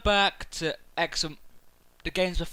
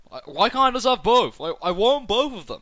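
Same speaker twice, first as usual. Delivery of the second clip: higher and louder, pleading excitedly; excited whine; 4 semitones higher, 4 dB louder than usual. Real, not acted. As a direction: beg like you want both. Can't choose which to buy.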